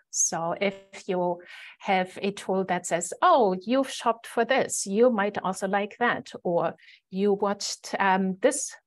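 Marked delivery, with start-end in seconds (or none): none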